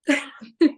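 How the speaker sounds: a quick burst of laughter; short real laugh. Real, not acted. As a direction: laugh out loud at the memory. Laughing at partner laughing at her.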